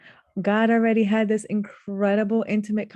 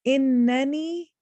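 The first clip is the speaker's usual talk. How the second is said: Innani higher, slow and rising; raised, drawn-out Innani; very strong emphasis; 6 semitones higher, 4 dB louder, slower than usual. Shown as a demonstration. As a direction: hold the doubled n of Innani with force. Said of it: "is a very strong emphasis".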